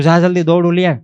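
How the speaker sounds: loud, high, hurried command; mother ordering the brother to fetch a rope fast, loud and high; urgent order. Retold or imitated. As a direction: send him running for the rope.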